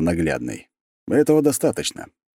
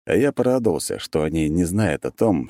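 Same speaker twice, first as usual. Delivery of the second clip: plain reading voice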